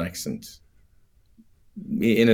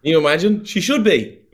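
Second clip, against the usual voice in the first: excited; 9 semitones higher, 4 dB louder than usual. Real, not acted. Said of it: "excited reply".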